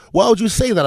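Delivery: high-pitched imitation of her protest; young lady arguing. Young lady challenging his take on preseason, high-pitched protest. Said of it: "I had this argument with a young lady".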